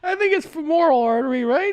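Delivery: high, unsure correction; gently correcting the word; questioning correction. Real, not acted.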